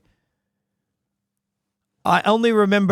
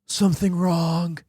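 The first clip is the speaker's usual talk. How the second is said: Nick Cage. Acted. Player testing a voice for the actor character, Cage-like. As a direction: try out a measured actor voice. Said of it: "It's like Nick Cage".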